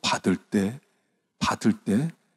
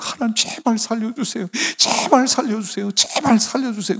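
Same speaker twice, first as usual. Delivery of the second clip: much higher, crying out for help; hanging on and pleading for life; desperate plea; 12 semitones higher than usual. Acted. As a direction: beg for rescue as if you are falling. Clinging to a root over hell.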